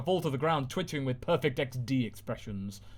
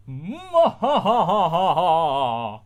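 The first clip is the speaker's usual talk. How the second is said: loud slow forced ha ha; awkward laugh; 4 semitones higher, 9 dB louder, much slower than usual. Acted. Villain back, nervous forced laughter. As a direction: laugh to fill the silence.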